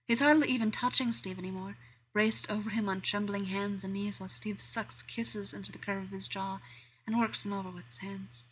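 ordinary delivery, nothing marked

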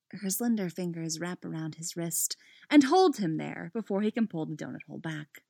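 ordinary delivery

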